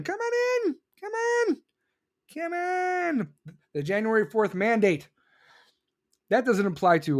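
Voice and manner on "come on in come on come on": very high, drawn-out calling voice; hammed-up high-pitched invitation; mock welcome. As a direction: beckon everyone in with an exaggerated high call. Biden imagined welcoming people over border.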